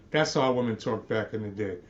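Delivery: ordinary talk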